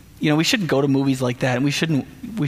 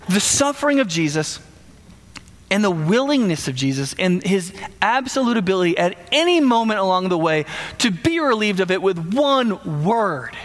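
usual speaking voice

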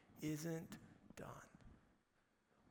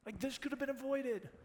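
higher-pitched, frustrated imitation; mildly angry; 9 semitones higher than usual. Putting yourself in Jesus' shoes, mildly angry. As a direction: protest with a touch of anger. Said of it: "it makes you angry".

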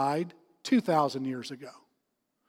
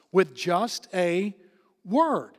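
each word set apart in "just a word"; slow right down and land on "word"; deliberate, weighty pause before "word"; slow emphasis; much slower than usual. Real, not acted. Preacher stresses the power of word.

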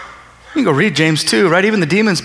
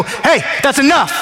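higher, sharp cut-off; stern rebuke; 7 semitones higher than usual. Retold or imitated. Preacher to someone yelling in the back, stern rebuke. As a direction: stop a yeller in their tracks. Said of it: "starts yelling and screaming, and I just go".

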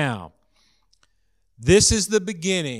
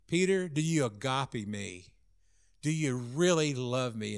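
softer, searching question; tender question; 2 semitones lower, 7 dB quieter than usual. Acted. Jesus asking Peter a third time, soft and tender. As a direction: ask it gently, acting out the teacher.

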